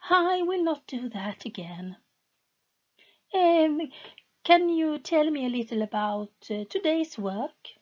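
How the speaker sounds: high, hesitant pleading voice; lightly voiced anxious wife; timid wife. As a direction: ask carefully, afraid to upset him.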